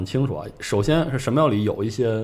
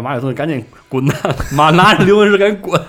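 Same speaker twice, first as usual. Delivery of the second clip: urgent shouted order, higher and louder, breaking into laughter; playful raised-voice command with a laugh; mock shouting; 7 semitones higher, 10 dB louder than usual. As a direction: yell at him to get lost, hammed up. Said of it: "大喊说".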